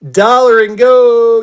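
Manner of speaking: loud, slow drawn-out call; auction call. Real, not acted. Host kicks off the stack for viewers, drawn-out loud call. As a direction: stretch it out like an auctioneer starting the bidding.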